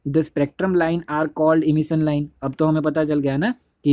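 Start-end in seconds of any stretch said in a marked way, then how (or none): 0.1-2.3 reading the book line very slowly, word by word; slow read-along. Shown as a demonstration. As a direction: dictate each phrase slowly so the class can follow. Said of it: "but के आगे वाला line". (किताब की line बहुत धीरे-धीरे, शब्द-शब्द पढ़ना)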